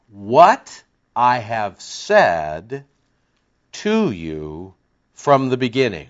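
very slow, word by word; slow dictation. Shown as a demonstration. Preacher dictating his translation for the congregation, very slow. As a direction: spell it out so they can write it. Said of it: "I'll give it to you one more time".

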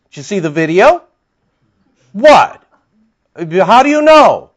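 loud, pointed, incredulous questions, each one pressed harder; mocking challenge. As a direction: needle them: really, how would you know?.

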